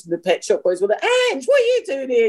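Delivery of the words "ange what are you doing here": put-on high, delighted hail to an old friend; pitched-up retold hello; surprised greeting. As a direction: imitate a friend's happy surprise.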